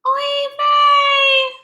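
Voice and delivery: shrill, loud anguished wail; shouted oy vey. Acted. Mama Gefilte wailing as the last fish leaves. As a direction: scream oy vey in despair. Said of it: "Mama Gefilte cried out".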